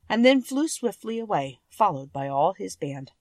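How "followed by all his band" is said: plain reading voice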